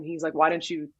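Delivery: ordinary delivery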